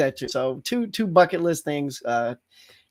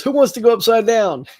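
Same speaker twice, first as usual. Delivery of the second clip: raised, louder tour-guide call; calling out to the group; 6 semitones higher, 5 dB louder than usual. Retold or imitated. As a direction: imitate an upbeat call for volunteers. Quoting the guide's call-out to riders.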